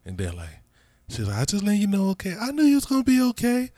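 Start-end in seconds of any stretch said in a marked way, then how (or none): 1.4-3.7 soft, high-pitched and gentle; gently reassure, pitched up high; tender retold reassurance. Mother reassuring her child on the phone, tender.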